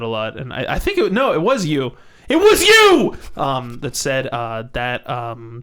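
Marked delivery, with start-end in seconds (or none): 0.7-3.2 building to a high shout; excited. Real, not acted. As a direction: burst out with sudden recognition. Excited realization, rising shout.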